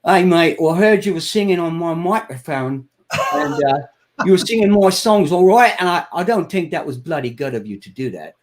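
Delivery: mock-stern English accent impression. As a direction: put on an English accent, telling someone off. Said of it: "in his English accent".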